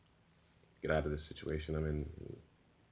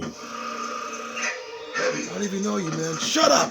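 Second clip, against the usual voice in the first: much higher and louder, blowing up into a yell; furious shout; 8 dB louder, much slower than usual. Acted. The vegan villain screaming at Lobo to shut up. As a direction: scream shut up in his face.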